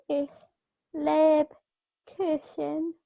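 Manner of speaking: very slow, halting attempt; tentative learner stretching each part. Real, not acted. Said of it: "you sounded like a tentative child".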